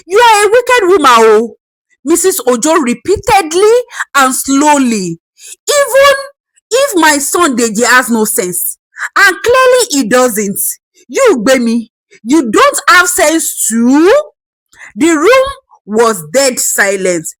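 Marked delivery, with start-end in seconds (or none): 0.1-1.6 high, loud and fast; shouted accusation. Acted. Grandmother shouting at the wife, high, loud and fast. 5.7-14.1 Even thrown out high; furious scolding. Acted. Grandmother berating the wife, furious.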